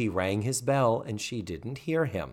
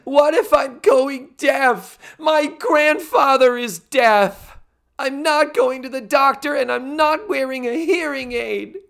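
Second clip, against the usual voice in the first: much higher, slow, whiny mock teen; whiny overwrought teen; 13 semitones higher, 6 dB louder, slower than usual. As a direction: overplay a teen's hysterical dread of going deaf. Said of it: "Tootie's response is".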